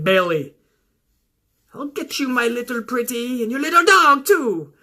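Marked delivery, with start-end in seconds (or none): 1.7-4.7 lightly voiced Wicked Witch. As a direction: menace like a cartoon witch. Quoting the Wicked Witch line.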